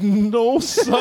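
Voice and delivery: high-pitched servile character voice; imitating a comedian's meek bit character; meek, climbing high answer; Damon Wayans. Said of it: "sitting there like".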